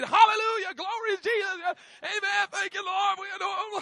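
high, loud praise shouts; joyful shouting to God; shouted praise. Retold or imitated. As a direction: shout your praise across the campus. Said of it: "We're shouting and singing and praying".

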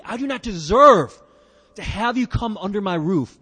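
deserve hit hard and loud; stress on deserve. Acted. Preacher repeating the centurion's humble words, deserve stressed. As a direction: lean on deserve, then ease off. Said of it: "again, humility".

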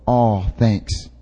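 low, soft, offhand thanks; perfunctory. Retold or imitated. Preacher imitating our routine response to God's love, perfunctory. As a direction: toss it off quietly as a formality. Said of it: "act like it's a formularity".

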